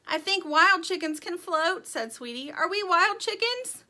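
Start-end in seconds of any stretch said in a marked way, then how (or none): 0.0-1.9 higher, louder hopeful call; raised voice. A narrator's reading. Sweetie calls out amid flood. 2.5-3.8 high, loud eager question; raised-voice question. A narrator's reading. Sweetie asks amid flood.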